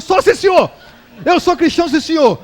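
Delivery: high, very loud agreement; frantic mock surrender. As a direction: imitate a slapped man yelling his compliance. Slapped man frantically giving in.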